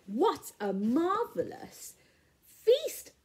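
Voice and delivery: ordinary delivery, nothing marked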